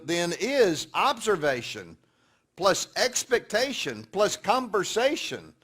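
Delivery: loud, slow, emphatic sermon climax; preaching at full force. Acted. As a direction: drive each word home to the congregation. Preaches the summary.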